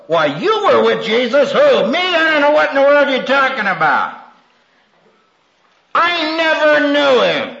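Peter's denial. Retold or imitated. Peter denying Jesus to a girl, flustered and high. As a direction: brush off the accusation, playing dumb. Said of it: "Who said that?".

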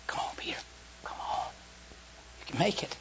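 soft whispered urging; Jesus whispering encouragement to Peter. Acted. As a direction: coax him gently, almost a whisper.

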